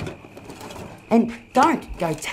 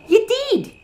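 bickering; 14 semitones higher, 5 dB louder than usual. Acted. Bickering, high and loud.

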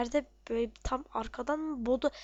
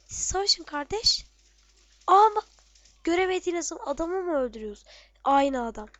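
loud, higher indignant protest; indignant outburst; 4 semitones higher, 6 dB louder than usual. Real, not acted. Gamer protesting to teammates about the invisible enemy, loud outrage.

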